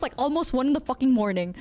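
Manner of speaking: ordinary talk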